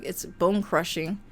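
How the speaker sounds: ordinary delivery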